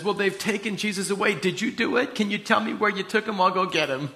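imitating Mary's high, anxious plea; acted pleading. Mary pleads with the supposed gardener.

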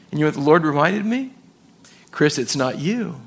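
ordinary talk, nothing marked

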